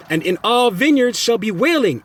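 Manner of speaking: slow prophetic declaiming; preaching scripture. Acted. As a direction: proclaim the woe slowly. Reading scripture.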